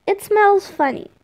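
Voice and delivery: put-on high whiny kid voice; complaining kid. A narrator's reading. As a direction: complain like a sulky little boy. Child Tom complaining about chair.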